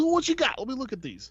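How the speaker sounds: high Barbarino-style wise-guy voice; artist's voice. Retold or imitated. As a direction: ask it like a Brooklyn tough guy. Voicing the artist.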